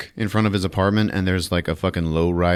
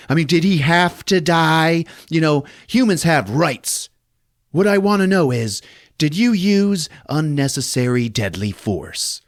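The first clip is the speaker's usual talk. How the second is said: mock prissy reporter; 8 semitones higher than usual. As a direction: needle him like a nosy reporter. Reporter questioning Cobra.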